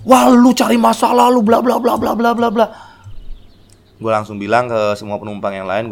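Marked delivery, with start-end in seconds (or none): 0.1-2.7 high, loud taunting yell; high mocking heckle; passengers yelling. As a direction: heckle him like an angry passenger. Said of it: "Terus penumpang yang lain langsung".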